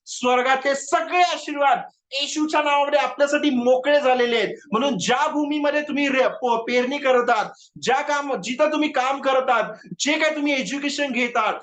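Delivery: fervent preaching. Real, not acted. Preacher declaring blessings in Jesus' name over the congregation, fervently. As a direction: proclaim it insistently over the congregation.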